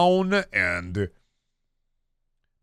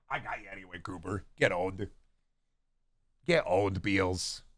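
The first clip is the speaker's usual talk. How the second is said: soft teasing; 8 semitones lower, 12 dB quieter than usual. Real, not acted. Teasing the cat softly.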